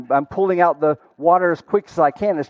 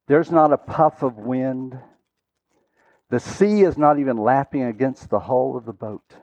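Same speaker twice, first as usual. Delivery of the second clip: ordinary delivery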